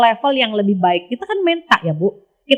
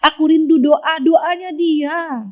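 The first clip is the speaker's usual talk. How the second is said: slow, higher, yearning; tender longing; 5 semitones higher, slower than usual. Retold or imitated. Voicing God missing prayers, slow and tender. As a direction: draw out the longing slowly. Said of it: "kata Allah".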